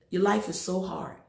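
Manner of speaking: normal talking voice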